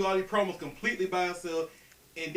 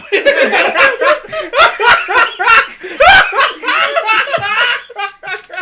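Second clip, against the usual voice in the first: howling with laughter; cracking up at the joke; loud laughing fit; 17 dB louder than usual. Real, not acted. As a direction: burst out in a big loud laugh. Huge laugh at Ali joke.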